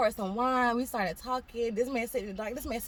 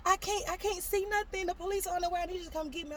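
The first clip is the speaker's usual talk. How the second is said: higher-pitched, panicked little sister on the phone; little sister faking a car accident in a higher, pleading voice; fake crying sister; 11 semitones higher than usual. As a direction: sell the fake emergency in a high, upset voice. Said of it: "call me and start crying".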